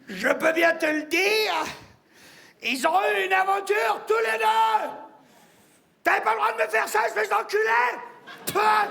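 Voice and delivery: high, outraged yell, building to spat-out words; shouting. Acted. Grieving friend cursing the dead man, furious shouting. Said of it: "j'aurais pas dû crier non plus".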